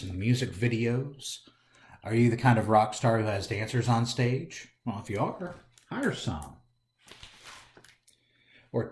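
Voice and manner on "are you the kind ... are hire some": ordinary delivery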